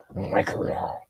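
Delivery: breathy disgusted whisper; muttered whispered aside. Real, not acted. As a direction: hiss it under your breath with distaste. Disgusted aside about Pelosi.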